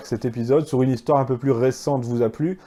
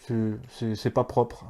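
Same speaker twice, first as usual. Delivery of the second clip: softer, mock disapproving voice; mimicked critics; 3 semitones lower, 8 dB quieter than usual. Host mimicking office critics in Paris, mock disapproval. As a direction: mildly imitate a hesitant, squeamish objector.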